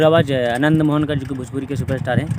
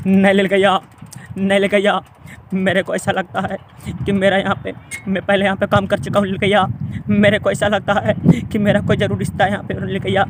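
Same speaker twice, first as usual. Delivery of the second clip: higher, breathy-free film hero voice held throughout; Shah Rukh Khan impression; 6 semitones higher than usual. Mimic on stage doing a film hero, higher wistful star voice. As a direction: play the film hero musing he belongs here. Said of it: "Shahrukh Khan बोलते हैं".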